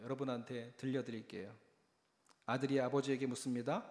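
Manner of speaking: normal talking voice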